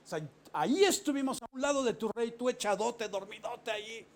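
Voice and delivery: high, loud mocking call; mocking yell at Abner; shouting. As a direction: taunt him with a yell, then fade to a sneer. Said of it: "y gritándole".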